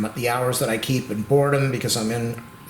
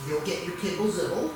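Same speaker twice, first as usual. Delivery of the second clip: higher, sing-song treat talk; high doting pet voice; 4 semitones higher than usual. Real, not acted. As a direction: tempt her with a snack, sweetly. Offering the dog a snack.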